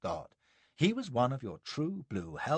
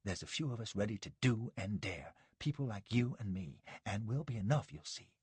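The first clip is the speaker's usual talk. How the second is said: dropped voice; 8 dB quieter than usual. A narrator's reading. Jerry confiding to Josh out of Sanborn's earshot, hushed. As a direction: keep it down so the host won't hear. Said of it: "He had dropped his voice".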